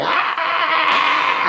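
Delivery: high hammed-up scream; mock scream. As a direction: yell it like a trapped man. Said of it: "he's like".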